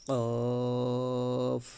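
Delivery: কফ said full and short, never like কাফ; Arabic letter demo. Shown as a demonstration. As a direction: model কফ clearly apart from কাফ. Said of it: "যাতে করে কাফ না হয়ে যায়". (কাফ থেকে আলাদা করে কফ উচ্চারণ)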